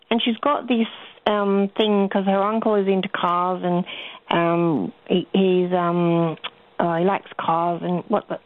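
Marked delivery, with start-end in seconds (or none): none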